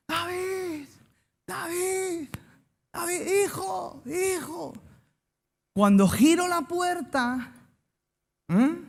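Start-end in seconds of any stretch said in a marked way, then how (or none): 0.1-4.7 high, soft, far-off cry of a frantic father; frantic father calling for his son in a mall; calling lost son. Retold or imitated. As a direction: call out for the lost boy, high and hushed.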